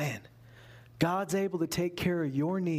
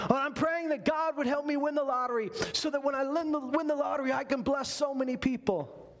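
higher-pitched mock Southern accent; 9 semitones higher than usual. Would-be lottery winner promising to bless people, mock Southern accent. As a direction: imitate an earnest lottery pray-er with a Southern twang. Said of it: "they didn't have a Southern accent".

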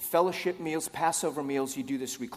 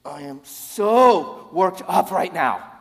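loud, slow, over-the-top outburst; worked up; 6 semitones higher, 13 dB louder, much slower than usual. Acted. Jesus, worked up, erupting to his disciples, loud and overwrought. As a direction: draw it out and erupt at full strain. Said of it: "now he's worked up again".

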